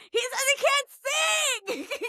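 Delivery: very high, hammed-up outburst; frantic retold wail. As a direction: wail the bad news to a friend. Retold frantic phone call.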